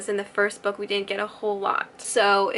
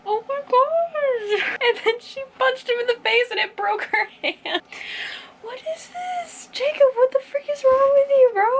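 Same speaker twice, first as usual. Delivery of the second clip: high, wailing outrage, half crying, half laughing; outraged disbelief; 13 semitones higher than usual. Real, not acted. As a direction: squeal in shocked disgust at the book. Outraged reacting to Jacob's kiss.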